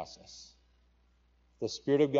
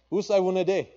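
"Usai wunade" called out higher and louder; calling in pidgin; 7 semitones higher, 6 dB louder than usual. Acted. Preacher quoting God in pidgin, a raised call. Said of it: "is how we say it in pidgin".

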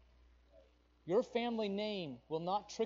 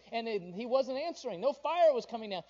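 ordinary talk, nothing marked

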